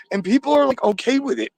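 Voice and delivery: can't believe anyone accepts it; incredulous outburst. Real, not acted. Caller fuming to host, high disbelieving outburst. Caller ranting about fans.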